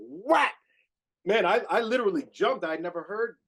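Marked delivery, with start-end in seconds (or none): 0.1-0.5 sharp high yelled whack like a snare hit; imitated snare drum crack. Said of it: "snare drum hit imitated".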